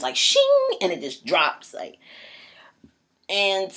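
ordinary talk

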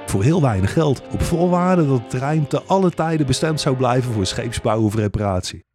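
plain reading voice

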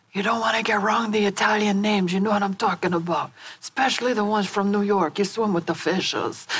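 hammed-up New York Italian mobster accent; playful mock Italian New York accent. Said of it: "my terrible Italian New York accent".

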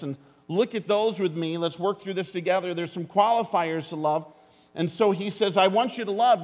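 rally the congregation, hammering every point; preaching at full force. Acted.

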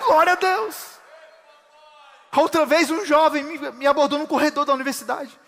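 loud, high sermon voice, Aleluia drawn out slow; full-force preaching. Acted. Pastor preaching a convert's testimony to the congregation, loud and high. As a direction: proclaim the testimony to the church.

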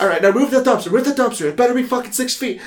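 high, hammed-up commanding voice; bossy mock orders. Charlie bossing Dee about the dumpster, high and mock-stern.

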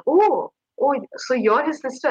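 high-pitched retold "oh!" of recognition; surprised recognition. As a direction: act out their pleasant surprise. Band members recognise her, retold.

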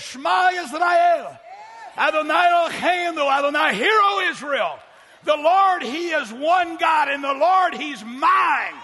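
loud, ringing morning proclamation; bold declaration. Shown as a demonstration. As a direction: proclaim the creed with conviction. Demonstrates his morning declaration to God.

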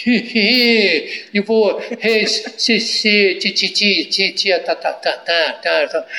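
hammed-up radio stutterer trying to recite; stuttering character. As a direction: stutter helplessly through the syllables. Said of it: "ele aparecia como gago".

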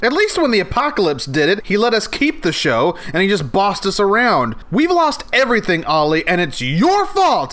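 high whiny blame; upset. Acted. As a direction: complain at the top of your voice. Blaming Ollie, upset.

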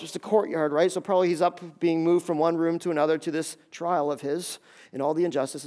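normal talking voice

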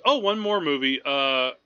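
ordinary talk, nothing marked

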